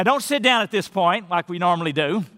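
usual speaking voice

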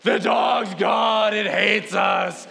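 slow, high wail of imitated children; hammed-up kids' howl, words drawn out; mock howling. Two children behind mom at neighbors' doors, mock howling.

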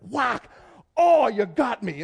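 loud, high mock cry of being hit; play-fight shouts. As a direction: yell it out like a kid losing a pretend sword fight. Boys' mock sword fight retold.

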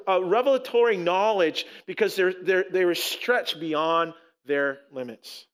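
ordinary talk, nothing marked

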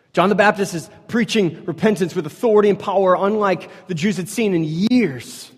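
loud, high preaching voice; full-force sermon delivery. Acted.

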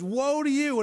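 Jesus's rebuke. Retold or imitated. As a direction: call out the woe like a prophet. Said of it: "he says".

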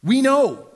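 loud high "know" with smug emphasis; mock the Pharisees' proud certainty. Preacher voicing smug Pharisees, proud loud "We know".

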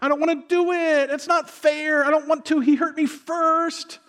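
high-pitched mock toddler protest; whining toddler. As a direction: whine like a small child, dragging out the last words. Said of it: "I act like a toddler".

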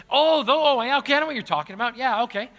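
loud, high burst of realization; sudden recognition. Real, not acted. Pastor suddenly grasps an audience member's question, loud realization. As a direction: exclaim it quickly as it clicks.

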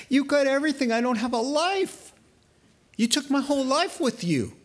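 high, whiny mock complaint; mocking whine. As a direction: mimic a self-pitying man, pitched high.

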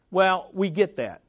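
ordinary talk, nothing marked

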